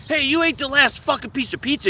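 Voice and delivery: high-pitched mock-angry accusation; hammed-up petty outrage; mock sibling outrage. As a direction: play a brother griping over food. Acted sibling quarrel over pizza.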